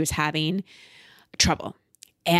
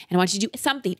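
ordinary talk

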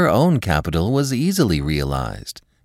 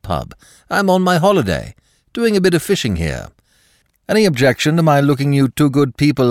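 cheery Dick; 6 semitones higher, 3 dB louder than usual. Acted. Dick's cheery phone chatter.

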